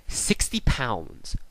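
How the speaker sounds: slow, flat punchline reveal; slow deadpan reveal. Real, not acted. Telling viewers about a lowball offer, slow deadpan. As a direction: let the number land slowly.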